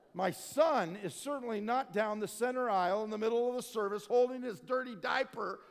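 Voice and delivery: loud, high comic exasperation; mock disbelief. Preacher dad denying his son is interrupting church, loudly exasperated. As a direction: build to a near yell of disbelief.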